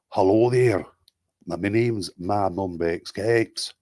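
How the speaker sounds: slow, drawn-out British regional accent; demonstrating a regional accent; put-on regional accent. Shown as a demonstration. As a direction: greet in a put-on accent and stretch the name. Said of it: "give one in as many accents as you want".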